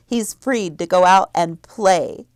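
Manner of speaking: usual speaking voice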